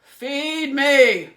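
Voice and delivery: very loud, very slow monster pig bellow; giant pig shout. A narrator's reading. Giant pig demanding food, slow roaring bellow. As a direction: bellow your hunger like a giant beast. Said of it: "the giant pig shouted".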